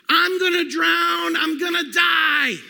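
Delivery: loud, high, slow terrified yelling; panicked yell. Retold or imitated. Boy in a sinking canoe yells to his family in panic. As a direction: yell it like a kid sure he'll drown. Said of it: "So I'm yelling".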